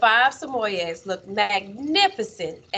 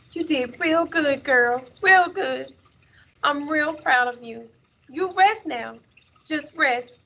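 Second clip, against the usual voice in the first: put-on high, tender child's voice; earnest high-pitched praise, unhurried; little boy comforting; 6 semitones higher than usual. A narrator's reading. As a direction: soothe a beloved dog as a small boy. Boy comforts his dead dog.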